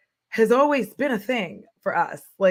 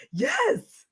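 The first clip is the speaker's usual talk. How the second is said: high squeal of delighted agreement; squealing yes. Real, not acted. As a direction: let it burst out in a squeal. Crying laugh, pitch leap.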